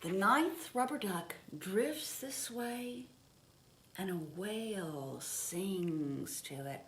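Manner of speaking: put-on gentle read-aloud pace for children; storybook reading. A narrator's reading. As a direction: read it like a picture book at bedtime. Reading picture book aloud.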